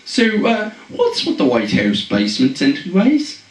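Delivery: high, hammed-up British accent; playful fake British accent. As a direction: chirp it out like a mock posh Brit. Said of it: "threw on my British accent".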